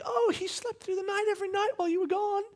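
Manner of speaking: high, soft sweet babysitter impression. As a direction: chirp it like the cheerful sitter. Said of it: "she's like".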